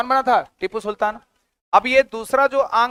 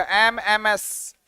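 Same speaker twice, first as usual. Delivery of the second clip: MMS stretched slowly, letter by letter; teacher stretching the memory trick for students; drawn out mnemonic; 4 dB quieter, much slower than usual. Shown as a demonstration. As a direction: draw out each letter so it sticks.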